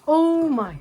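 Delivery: loud, high, drawn-out exclamation; amazed. Real, not acted. Teacher amazed at the strength test, exclaiming loudly. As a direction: cry out in astonishment, stretching the first word.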